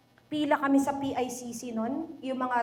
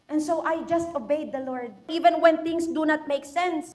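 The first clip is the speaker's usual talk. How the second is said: ordinary delivery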